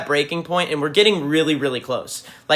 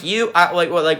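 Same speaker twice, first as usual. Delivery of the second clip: ordinary talk, nothing marked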